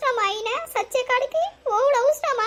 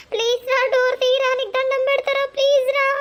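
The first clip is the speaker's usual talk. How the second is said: higher, louder frantic begging; desperate pleading; 4 semitones higher, 3 dB louder than usual. Acted. Wife begging husband behind locked door, frantic loud plea. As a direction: beg him through the door, frantically.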